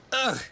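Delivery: high cold shudder; shivering ugh. A narrator's reading. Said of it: "he shivered".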